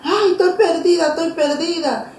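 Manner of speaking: high-pitched, crying plea reenacted; tearful pleading. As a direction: beg for help as if lost and weeping. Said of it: "Y yo comencé a llorar". (súplica aguda, entre sollozos)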